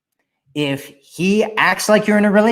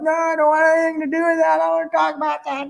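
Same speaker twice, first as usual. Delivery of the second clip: high, fast whiny denial; high, hurried voice of someone dodging their past; mock avoidant voice; 12 semitones higher, much faster than usual. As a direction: squeal it high and fast, shutting the topic down. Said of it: "You're gonna be like".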